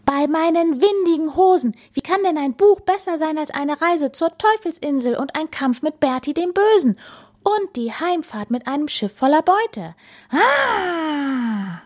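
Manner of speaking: high-pitched blustering captain; indignant pirate captain. Acted. Captain tempting Bella away from her book, indignant. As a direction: protest high, ending in a long relishing "Ah".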